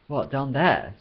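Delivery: high, wrinkled-nose distaste; severe distaste. A narrator's reading. Trillion balks at going down, distaste. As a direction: recoil from the idea. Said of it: "with severe distaste".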